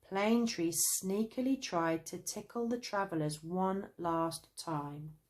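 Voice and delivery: ordinary delivery, nothing marked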